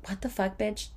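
quiet, under-the-breath retort; muttered disbelief. Retold or imitated. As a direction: drop it to a soft aside, like a private thought. Retold first reaction to refund demand.

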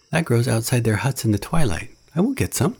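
eager. A narrator's reading. Mowgli eagerly resolves.

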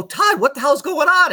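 panicked ask to the coach; alarmed question. Retold or imitated. Asking the head coach why the bus is burning, alarmed.

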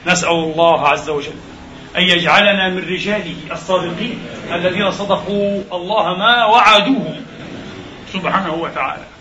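ordinary delivery

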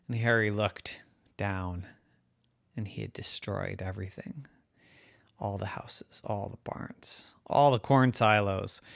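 soft, low narration; hushed aftermath. A narrator's reading. Storyteller describing the ruin Harry caused, hushed. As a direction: let it sink, surveying the damage quietly. Wind surveys the destruction, regretful.

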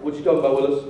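low comic catchphrase delivery; catchphrase imitation. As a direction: drop low for a joking TV quote. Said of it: "He's probably like".

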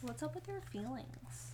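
whispering. Pretend. Whispered echo back.

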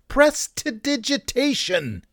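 "prestidigitation" slow and high, syllable by syllable; showy enunciation. Shown as a demonstration. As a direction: sound out PRES-ti-di-ji-TAY-shun way up high. Sounds out the long word.

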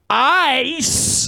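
"Ice" with a full long I, pitched high; careful standard ice. Shown as a demonstration. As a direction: overpronounce "Ice" as "eye-ss". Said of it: "I have to think about it".

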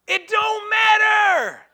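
loud, slow, high rebuke; booming retort from the pulpit; preacher's shout. Real, not acted. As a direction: slam the excuse down hard. Pastor rebuts excuse again.

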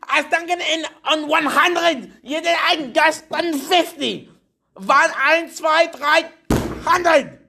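high, slow mock German shouting; fake German shouting. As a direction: shout gibberish German like a cartoon Nazi. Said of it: "shouting in German or fake German".

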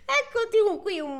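can't keep a straight face, laugh it out. Real, not acted. Reader losing it to laughter while voicing Matushka.

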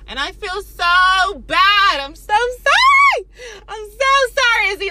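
loud, high, drawn-out mock-tearful wail; wailed apology. Teller apologizing to the friend she snapped at, hammed-up wail. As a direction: ham up the remorse, stretching the words.

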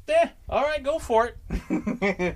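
high, slow, lazy cop shrug; casual cop. Retold or imitated. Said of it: "and the cop's like".

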